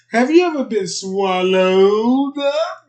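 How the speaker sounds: loud, high, slow preacher boom; booming Moses. Retold or imitated. Moses threatening Pharaoh in a loud, slow boom. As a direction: thunder it down like a prophet. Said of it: "Moses told Pharaoh".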